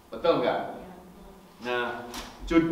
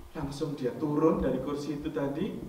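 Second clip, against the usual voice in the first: usual speaking voice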